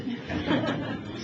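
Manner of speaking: a quick, high, airy laugh; high breathy laugh. Real, not acted. After recalling his mother's warning, a high breathy laugh. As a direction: laugh it off lightly.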